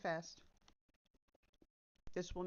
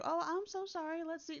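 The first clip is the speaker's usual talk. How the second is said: mock perky sympathy; 8 semitones higher than usual. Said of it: "I don't like the perky".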